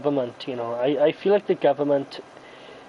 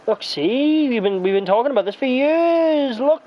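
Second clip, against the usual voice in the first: mocking government voice; 9 semitones higher than usual. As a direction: squeak it out like a fake official. Mocking government, high pitched.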